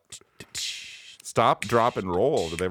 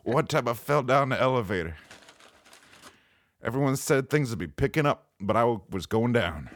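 Mitch Hedberg impression; 4 semitones higher than usual. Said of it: "I'm Mitch Hedberg".

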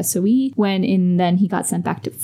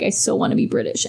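mock pining; 10 semitones lower than usual. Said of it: "he'd be like".

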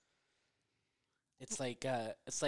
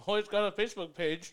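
teasing; 9 semitones higher, 11 dB louder than usual. Real, not acted. Teasing announcement, laughing.

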